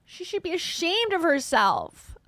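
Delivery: mock scolding. Mock scolding opener, far voice.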